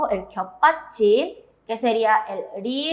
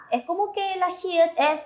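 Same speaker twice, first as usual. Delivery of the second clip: usual speaking voice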